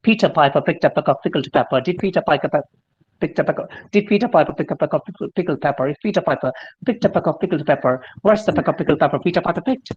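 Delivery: rapid drill, Peter Piper at speed; tongue twister practice at full speed; fast tongue twister. Shown as a demonstration. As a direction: rattle off the tongue twister quickly. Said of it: "Like you can practice".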